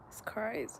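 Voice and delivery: soft unvoiced whisper. Real, not acted. Reflecting on a death, whispered in disbelief. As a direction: let it fall to a breath. Grieving the deceased friend.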